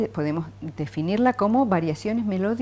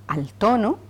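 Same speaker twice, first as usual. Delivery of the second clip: ordinary talk, nothing marked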